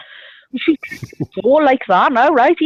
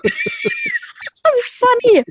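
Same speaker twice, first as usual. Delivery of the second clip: higher, laughter in the voice; laughing; 10 semitones higher than usual. Real, not acted. Friend recalling the police arriving, laughing. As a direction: still giggling as she says it.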